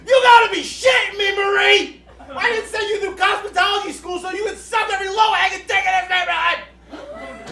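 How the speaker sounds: high, full-force street screaming; outraged shouting rant; yelling. Acted. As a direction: yell it across the block at Marie, high and furious. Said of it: "yelling at Marie".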